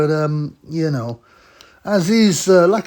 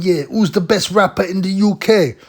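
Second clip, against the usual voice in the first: higher, louder mock Roadman question; Roadman host; 7 semitones higher, 5 dB louder than usual. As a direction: ham it up as the rapper host. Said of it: "And he starts going".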